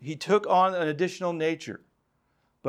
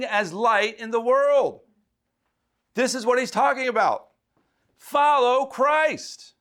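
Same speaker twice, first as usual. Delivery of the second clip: fervent preaching; 8 semitones higher than usual. Acted. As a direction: drive it home like a revival preacher. Preaching climax.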